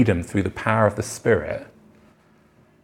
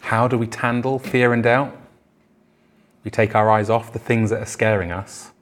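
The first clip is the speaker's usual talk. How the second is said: usual speaking voice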